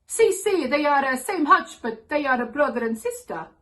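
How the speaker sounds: loud, hammed-up Italian accent; Italian father on the phone, high and loud; thick Italian accent. Father phoning his daughters about guinea pigs, thick Italian accent. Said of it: "said in his thick Italian accent".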